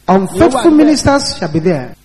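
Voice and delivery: normal talking voice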